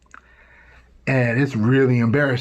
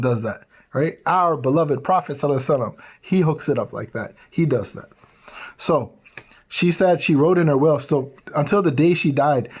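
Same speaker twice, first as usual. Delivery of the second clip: ordinary talk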